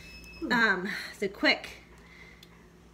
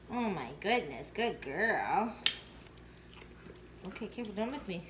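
soft, lower, soothing praise; 4 semitones lower, 7 dB quieter than usual. Real, not acted. Owner softly praising her dog during the nail trim. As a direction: coo gently to calm her.

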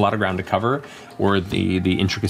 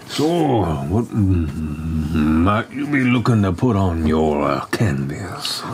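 put-on slow, musing old tattooist voice; the turtle tattooist asking a client what they want, slow and musing; tattooist Orly; 4 semitones lower, much slower than usual. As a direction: let the question linger as an artist sizing up a canvas.

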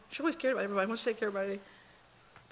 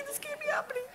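laughing disbelief; faster than usual. Retold or imitated. Mother recalling her toddler's defiance, laughing in disbelief. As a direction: laugh it off in helpless exasperation. Child disobeys yet again.

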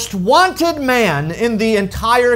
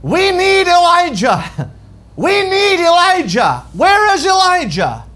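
crowd shouting; 8 semitones higher than usual. Acted. A kingdom in drought crying out for Elijah, shouting. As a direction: call out urgently, pitched well up. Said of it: "Can you hear the entire kingdom shouting".

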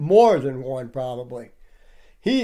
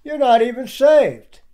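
mock quote; 6 semitones higher than usual. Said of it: "He said".

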